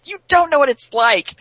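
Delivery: put-on loud, high-pitched boyish whine; mock tantrum, voice pushed way up; whiny protest. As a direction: act out a taunted kid protesting. Taunted boy villain whining back.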